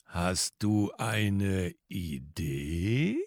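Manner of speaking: bored drawl. Acted. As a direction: sound deliberately boring and slow. Bored intro sketch, slow dull.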